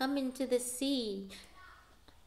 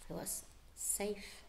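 whispered relief; soft whispered relief; 2 semitones lower, 14 dB quieter than usual. A narrator's reading. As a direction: breathe it out softly. Relieved hushed narration.

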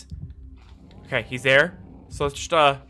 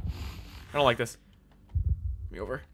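hushed fear; 6 semitones lower, 9 dB quieter than usual. Real, not acted. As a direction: keep it down so it can't hear. Hiding from monster nearby.

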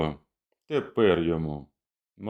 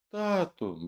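put-on high small child's cry; a child babbling for its father; 10 semitones higher, 4 dB quieter than usual. A narrator's reading. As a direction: plead like a frightened little child. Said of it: "белькотало".

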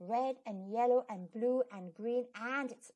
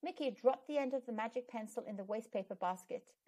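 ordinary delivery, nothing marked

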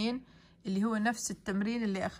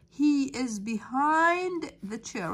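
slow dictation; 4 semitones higher, 3 dB louder, much slower than usual. Shown as a demonstration. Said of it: "teacher models answer".

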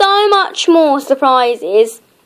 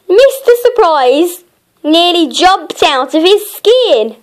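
ordinary delivery

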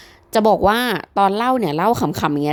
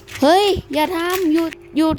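mock-pleading high and slow "เฮ้ย อย่าทำ หยุด หยุด"; playful imitation of a Thai plea to stop a fight; typical Thai bystander; 8 semitones higher than usual. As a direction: imitate a Thai onlooker begging them to stop, higher and slower. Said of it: "ปกติคนไทยใช่ไหม มันก็จะฟีลแบบ".

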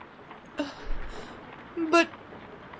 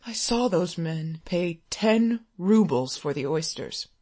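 ordinary delivery

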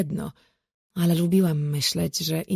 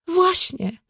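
sudden high eager exclaim; excited; 12 semitones higher, 5 dB louder than usual. A narrator's reading. Mother claps, thrilled by her daughter's remark. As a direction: pounce on the idea with delight.

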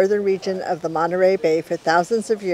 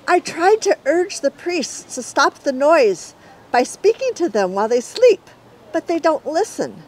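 higher, gentle spirit voice; lightly higher female figure; Humunia voiced; 9 semitones higher than usual. Humunia speaks in story.

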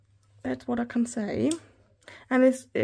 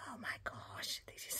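hushed breathy whisper of delight; 3 semitones lower, 19 dB quieter than usual. Real, not acted. Vlogger whispering to viewers over cute washi, hushed. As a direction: whisper it like a secret thrill.

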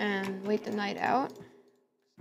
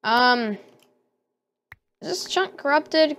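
ordinary talk

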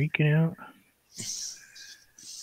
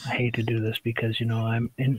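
whispered aside; 4 semitones lower, 7 dB quieter than usual. Real, not acted. Quiet aside while painting.

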